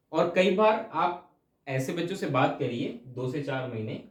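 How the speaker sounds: ordinary talk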